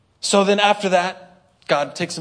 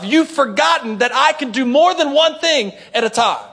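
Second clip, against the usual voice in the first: much higher and louder, emphatic; rising fervent preaching voice; preaching God's reply; 8 semitones higher, 6 dB louder than usual. Acted. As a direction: preach it out with force. Preaching God's words at full force.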